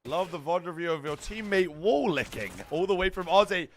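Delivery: normal talking voice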